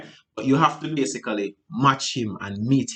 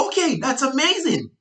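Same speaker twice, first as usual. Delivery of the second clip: overexcited reply; 13 semitones higher than usual. Shown as a demonstration. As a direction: gush way too brightly. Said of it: "No, you don't want any of that".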